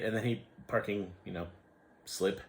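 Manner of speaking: ordinary talk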